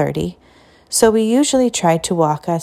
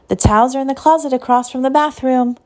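put-on old lady voice; 6 semitones higher than usual. A narrator's reading. Old teacher's voice.

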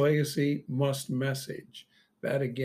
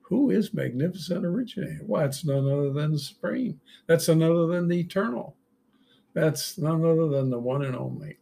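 ordinary talk

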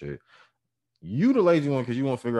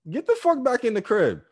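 high-pitched, louder order; mock angry yell; 14 semitones higher, 5 dB louder than usual. Mover ordering the customer back indoors, mock yell. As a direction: holler it with mock exasperation.

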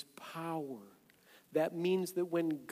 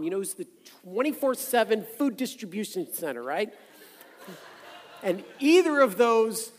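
loud, high, driving sermon voice; full-force preaching; 8 semitones higher, 11 dB louder than usual. Acted. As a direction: preach it out to the whole room.

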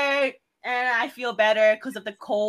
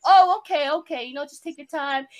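higher, hasty reassurance; imitated understanding manager; 3 semitones higher, 5 dB louder than usual. Manager hearing about personal issues, imitated reassurance. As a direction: play a boss awkwardly accepting an excuse. Voices manager accepting her explanation.

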